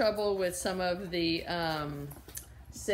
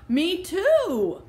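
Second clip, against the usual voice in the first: eager; 12 semitones higher, 12 dB louder than usual. Acted. Amelia eagerly agrees.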